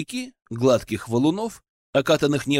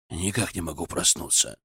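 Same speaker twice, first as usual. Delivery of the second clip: low, soft, drowsy mumble; half asleep, lower and softer; sleepy mumble; 8 semitones lower, 6 dB quieter than usual. Acted. Sleepy guest.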